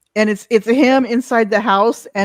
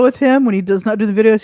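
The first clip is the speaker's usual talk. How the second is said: ordinary talk, nothing marked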